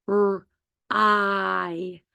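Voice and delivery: K-R-I as separate sounds "kuh, rr, eye"; sounded out. Shown as a demonstration. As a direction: stretch K-R-I sound by sound, pauses between. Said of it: "makes the I sound".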